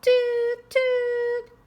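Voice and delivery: very high, loud, held horn toots; train horn toot. A narrator's reading. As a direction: honk it out like a train whistle. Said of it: "Pete gets to honk the horn".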